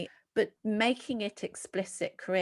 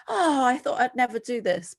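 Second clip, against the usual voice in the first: self-reproach; 5 semitones higher, 7 dB louder than usual. Acted. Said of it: "doing it and hating yourself".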